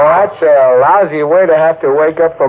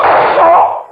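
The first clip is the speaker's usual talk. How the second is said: startled cry; 14 semitones higher than usual. Acted. Startled at sight of gun.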